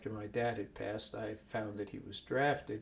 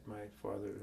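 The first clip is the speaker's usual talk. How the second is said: usual speaking voice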